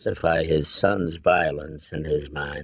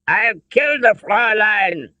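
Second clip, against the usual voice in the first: high, forceful German colonel's threat; spat through clenched teeth; 13 semitones higher, 3 dB louder than usual. A narrator's reading. Colonel holding Elsa hostage, spitting threats. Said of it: "spat through his clenched teeth".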